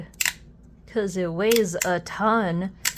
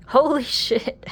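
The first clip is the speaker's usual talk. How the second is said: loud, high burst of disbelief; shocked exclaim; 7 semitones higher, 7 dB louder than usual. Real, not acted. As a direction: blurt it out, truly caught off guard. Surprised by slider's weight.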